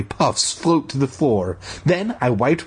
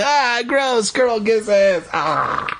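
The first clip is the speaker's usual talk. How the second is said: mock disgust; 11 semitones higher, much slower than usual. Mock boy disgust aside.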